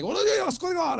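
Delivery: put-on a light caricature of the boyfriend; mock boyfriend challenge. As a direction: pipe it up high like a riled-up tough guy. Angry boyfriend arrives, kung fu.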